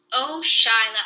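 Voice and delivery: loud shouted call. A narrator's reading. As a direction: shout her name across the rocks. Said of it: "I shouted".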